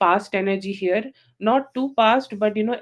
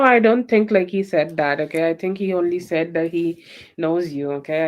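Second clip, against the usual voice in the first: ordinary delivery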